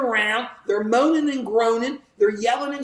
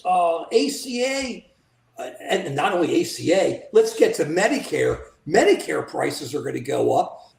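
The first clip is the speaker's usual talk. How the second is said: ordinary delivery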